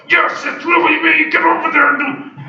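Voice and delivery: high, loud yelling command; screaming false voice. Retold or imitated. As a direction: yell it like a pushy tyrant voice. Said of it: "he's gonna scream and yell like".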